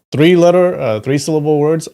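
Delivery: excited boast. Real, not acted. Bragging about easy names.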